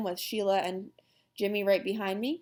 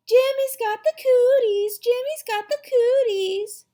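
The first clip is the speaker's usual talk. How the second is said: loud, high playground chant; girl's taunting yell; 9 dB louder than usual. A narrator's reading. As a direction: yell it tauntingly like a little girl. Same taunt chanted at Jimmy.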